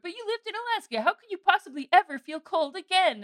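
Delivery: high, disbelieving imitation of other people; mock quote. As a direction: play their baffled question to the hilt. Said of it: "And people are like".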